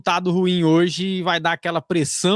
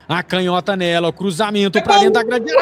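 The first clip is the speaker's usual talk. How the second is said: radio match commentary; live play-by-play; 6 semitones higher, 4 dB louder than usual. Acted.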